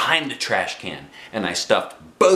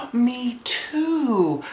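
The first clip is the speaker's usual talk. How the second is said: high sly agreement; much higher eager me too; eager little girl; 12 semitones higher than usual. Acted. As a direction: chime in quickly like a scheming kid. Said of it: "I said".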